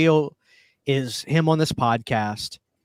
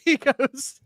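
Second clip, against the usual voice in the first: high, laughing false starts; cracking up; 10 semitones higher, 3 dB louder, slower than usual. Real, not acted. Host retelling Corey Feldman's absurd answer, cracking up. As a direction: try to get the story out through laughter.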